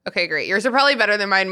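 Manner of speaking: ordinary delivery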